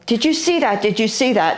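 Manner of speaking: pitched high, breathless disbelief; can't believe what just flew over; astonished. Retold or imitated. Stunned after the creature flew.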